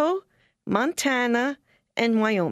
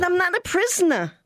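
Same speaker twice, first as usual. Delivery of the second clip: higher, emphatic protest; firm refusal; 8 semitones higher than usual. Retold or imitated. Missionary refusing her mother, higher and emphatic. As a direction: stand your ground, higher. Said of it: "I said".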